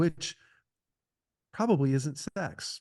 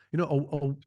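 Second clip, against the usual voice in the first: ordinary delivery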